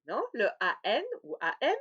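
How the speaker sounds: ordinary talk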